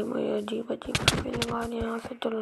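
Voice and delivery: usual speaking voice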